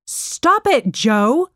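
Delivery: high-pitched call to stop; mother's shout. A narrator's reading. Mom scolds Joe from the living room, shouting. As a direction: call out sharply from another room. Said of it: "shouted her mom from the living room".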